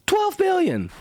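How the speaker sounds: high, disbelieving outburst; incredulous exclamation. Real, not acted. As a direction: shout the number in disbelief. Disbelief at market cap.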